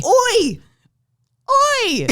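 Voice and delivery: loud, high cockney yelling; alarmed shout. Acted. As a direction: shout warnings at the boiling pot. Cooks spot boiling-over pot.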